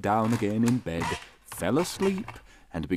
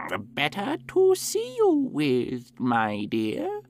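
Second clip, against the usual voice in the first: very high, slow fake granny; wolf squeaking as an old lady; wolf as grandmother; 7 semitones higher, slower than usual. A narrator's reading.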